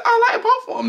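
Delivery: high, laughing mock voice; high giggly imitation; mocking quote. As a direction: mimic her line, squeaky and laughing. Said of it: "She said".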